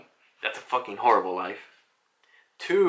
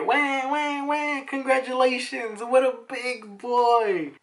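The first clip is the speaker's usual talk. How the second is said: very high put-on voice, slowing at the end; mock high-pitched baby fussing; hammed-up cooing; 12 semitones higher, slower than usual. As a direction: squeal it over a newborn, playfully exaggerated. Voicing birth cries and congratulations.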